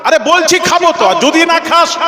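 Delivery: building from plain to high, insistent; rising argument. Acted.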